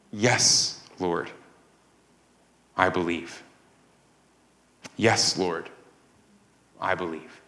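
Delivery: soft, low and reverent; hushed confession. Acted. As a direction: say it quietly, with space between phrases. Preacher voices Martha's reverent answer.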